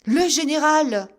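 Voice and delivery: loud, high alarmed shout; startled cry. A narrator's reading. Officer shocked to see the general, crying out. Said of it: "cria Digby".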